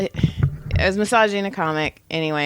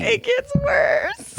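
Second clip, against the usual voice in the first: excited cry; much slower than usual. Real, not acted. Teasing buildup in comedy podcast.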